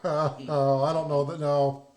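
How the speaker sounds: ordinary talk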